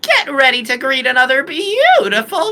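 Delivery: very high, loud mascot announcement; Monokuma. Acted. Podcaster continuing the impression for co-hosts, piercing high mascot voice.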